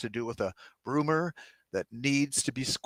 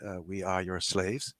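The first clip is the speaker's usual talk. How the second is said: eerie alien voice; 6 semitones lower, 5 dB quieter than usual. Acted. Alien Pope's voice through translator.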